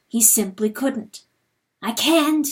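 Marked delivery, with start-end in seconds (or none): none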